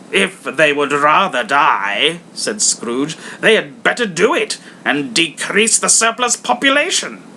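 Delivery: hammed-up cruel miser; harsh Scrooge. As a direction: sneer at the poor.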